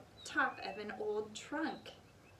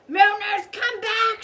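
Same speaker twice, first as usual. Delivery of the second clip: very loud, much higher bellow; shout; 10 semitones higher, 15 dB louder than usual. A narrator's reading. Captain calls his fleeing men, very loud shout. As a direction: bellow after them. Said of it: "shouted Captain Bones".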